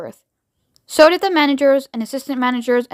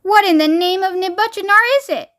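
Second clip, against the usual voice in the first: high-pitched, baffled exclamation; astonished cry; 7 semitones higher than usual. A narrator's reading. Shanks baffled by the strange craft, astonished cry. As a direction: cry out in disbelief. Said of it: "cried Shanks".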